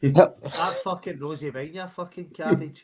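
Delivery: loud mock-insulting yell; rowdy pub shout at a pal. Real, not acted. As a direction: bellow the insult at your mate. Drunk banter, sudden outburst.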